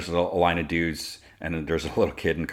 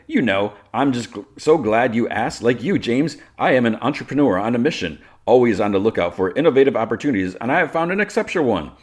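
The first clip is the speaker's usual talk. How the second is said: higher, projected sales-pitch voice; smooth entrepreneur; 6 semitones higher, 6 dB louder than usual. Acted. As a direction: pitch grandly, like a self-important businessman.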